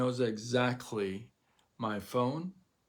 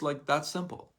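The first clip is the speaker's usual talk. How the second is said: usual speaking voice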